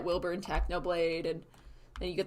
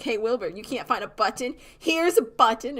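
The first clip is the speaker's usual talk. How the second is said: mock taunting; 3 semitones higher, 7 dB louder than usual. Prankster taunts with buttons.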